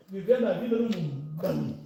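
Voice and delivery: usual speaking voice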